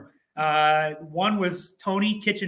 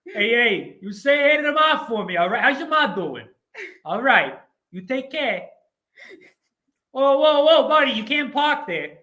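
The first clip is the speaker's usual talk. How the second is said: high, loud Brooklyn wise guy; faux Brooklyn accent; 5 semitones higher, 6 dB louder than usual. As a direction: play a 1940s Brooklyn man chatting up the neighbours, Brooklyn accent. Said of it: "the faux Brooklyn voice".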